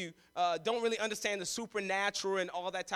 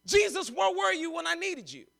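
desperate father; 7 semitones higher than usual. Acted. Father's anguished cry to Jesus.

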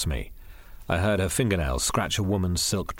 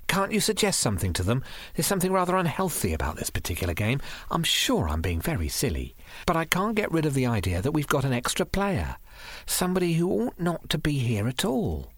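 lightly voiced woman, mostly higher; a hint of unease in a raised pitch; uneasy woman confiding; 6 semitones higher than usual. A narrator's reading. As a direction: confide a nagging worry.

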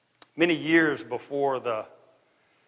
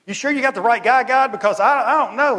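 rising higher and louder in disbelief; protest, why me, getting louder; incredulous Moses; 3 semitones higher, 5 dB louder than usual. Acted. Moses asking God in rising disbelief, louder. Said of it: "Moses was like".

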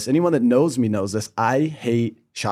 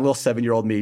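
ordinary talk

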